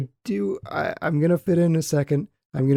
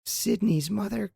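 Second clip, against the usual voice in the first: mimicking a ditzy cheerleader, pitched up; sing-song teenage girl; 5 semitones higher than usual. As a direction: gossip in an annoying higher-pitched sing-song. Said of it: "teenage girl kind of sing-song mimic".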